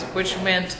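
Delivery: ordinary talk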